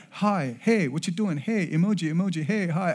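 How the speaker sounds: high, loud mock kid chatter; mimicked chat messages. Dad mimicking sixth graders' group chat, high and chirpy. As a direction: rattle off texts in a mock-excited high voice.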